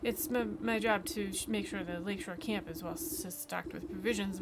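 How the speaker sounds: nervous stammer on first sounds; stuttering. Acted. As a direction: trip over the starting consonants. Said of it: "vocalization of the stutter".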